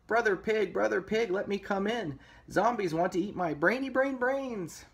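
high, squeaky-pitched piggy plea; pleading little pig. A narrator's reading. Pig begging brother to escape zombies, high pleading voice.

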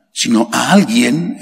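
normal talking voice

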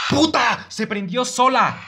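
high, explosive swearing; real jump-scare outburst; startled shouting. Real, not acted. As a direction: yell in shocked protest. Jump scare, light came on.

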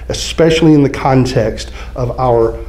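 ordinary talk, nothing marked

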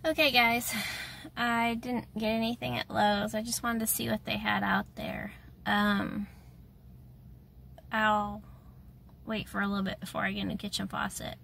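ordinary delivery

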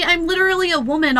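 ordinary talk

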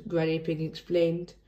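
ordinary delivery

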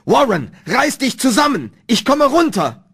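high, loud rallying cry; resolute shout. A narrator's reading. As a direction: shout down to a friend. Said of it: "fasste einen Entschluss und schrie".

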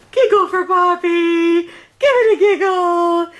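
slow, loud, high coaxing sing-song. Acted. Grandparent coaxing the baby to giggle for Poppy, drawn out. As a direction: stretch the words out to win a giggle.

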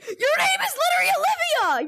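high, loud teasing yell; mock outraged shout. Real, not acted. Teasing friend, shouting insistence.

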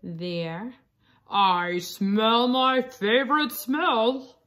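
slow, relishing wolf voice, sniffing in the words. A narrator's reading. Wolf sniffing at Red, slow relishing voice. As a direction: drag it out like a wolf on a scent.

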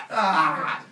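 loud comic howl; scream of pain. Acted. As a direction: cry out as if slashed. Father Sky cut once more.

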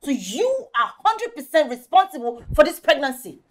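high-pitched, mounting tirade; heated, shrieky scolding; angry accusation. Acted. Friend accuses him in quarrel.

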